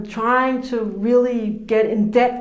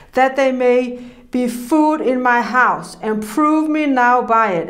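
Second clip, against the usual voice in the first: scripture reading; 2 semitones higher, 4 dB louder than usual. Acted. Preacher reading Malachi to the congregation, solemnly. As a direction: proclaim the verse with weight.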